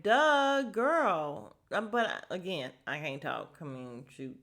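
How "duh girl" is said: sassy duh. Retold or imitated. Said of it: "And I'm like".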